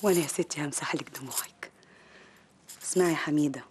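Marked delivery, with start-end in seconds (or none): 0.0-1.7 slow, soft whisper of comfort; tender whisper. Acted. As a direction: soothe her gently, barely voiced. Comforting her crying friend. (همس حنون ومطمئن)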